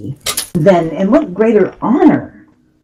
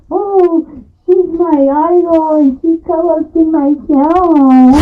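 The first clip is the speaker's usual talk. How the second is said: high, drawn-out mock gushing; gushing fan; 10 semitones higher, slower than usual. Host acting starstruck over her co-host, mock gushing. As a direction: swoon over an idol in a raised, stretched voice. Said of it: "it's like".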